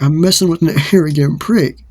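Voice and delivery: re-enacted cocky retort; insulting comeback. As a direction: throw back an acted insult with a slight laugh. Acted insult in quarrel.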